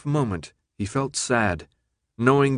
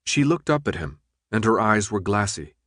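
plain reading voice

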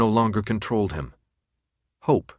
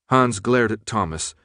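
plain reading voice

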